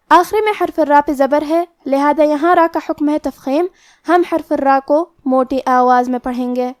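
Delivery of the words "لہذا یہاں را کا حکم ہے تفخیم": ordinary delivery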